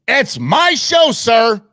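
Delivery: high, loud, slow and haughty; pompous protest of ownership; mock indignant.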